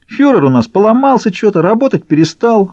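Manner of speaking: high-pitched, willing report; eager to tell. A narrator's reading. Уйбуй reports the broken leader to the baron, eager. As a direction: spill the news readily in a high voice. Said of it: "охотно поведал Уйбуй".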